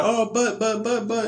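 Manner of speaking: put-on high, flustered child stammering; high child sputtering buts; stammering kid. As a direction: kid scrambling for an excuse. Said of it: "the kid be trying".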